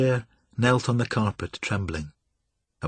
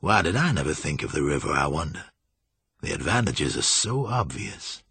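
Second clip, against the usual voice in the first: low-pitched thinking aloud; musing Julian; 6 semitones lower than usual. A narrator's reading. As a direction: ponder it in a low voice. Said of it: "mused Damon Julian".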